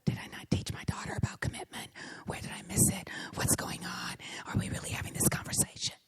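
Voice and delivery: hushed, rapid whisper to yourself; stunned inner thoughts. Retold or imitated. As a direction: whisper the panicked questions under your breath. Inner thoughts after daughter's news.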